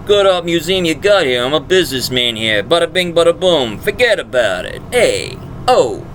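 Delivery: put-on brash New York accent as a tycoon character. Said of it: "give him a New York accent".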